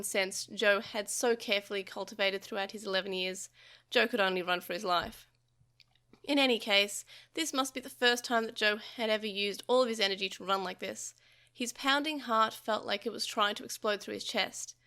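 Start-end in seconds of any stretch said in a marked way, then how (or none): none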